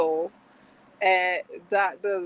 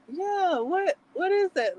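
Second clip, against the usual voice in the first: high-pitched, baffled questioning; puzzled reaction; 9 semitones higher than usual. Retold or imitated. Listener retelling her reaction to an odd word, baffled. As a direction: replay your own bewilderment.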